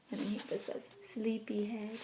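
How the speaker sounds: soft breathy whisper. Acted. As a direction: wake him gently in a hush. Dream voice turning into mom.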